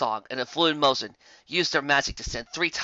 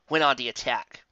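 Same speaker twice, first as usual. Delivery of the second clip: ordinary delivery, nothing marked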